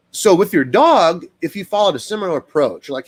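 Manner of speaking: ordinary delivery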